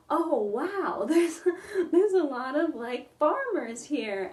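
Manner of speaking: laughing mock wonder at all the farmers; retold amazement. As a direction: act out the surprised visitor.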